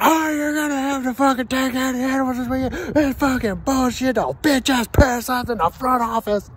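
high-pitched retold angry rant; strained, high cussing fit; pissed-off cussing. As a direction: rant at the top of your range, cussing someone out. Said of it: "I fucking got pissed off".

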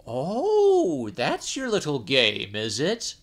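monkey privately catching on to the plot; knowing laugh. Acted. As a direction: chuckle as you see through the trick. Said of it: "thought the monkey".

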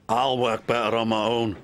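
haughty, dismissive tone; sniffy. A narrator's reading. Douglas dismisses missing his twin, sniffy. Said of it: "sniffed Douglas".

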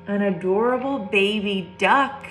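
usual speaking voice

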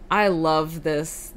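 ordinary talk

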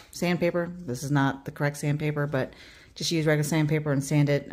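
normal talking voice

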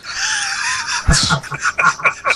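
high shrieking laugh; loud burst of laughter. Real, not acted. Bursts out laughing at misheard line.

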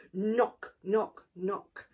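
ordinary delivery

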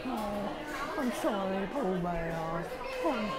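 a hint of a gentle, soft bear voice; lightly voiced Pooh Bear. Plays Winnie the Pooh.

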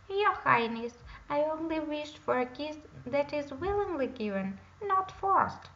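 earnest frog. A narrator's reading. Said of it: "explained the frog".

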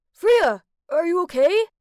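loud, high urgent call; worried call. Acted. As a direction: call out in alarm, voice rising on the question. Worried Kai calls out to Freya.

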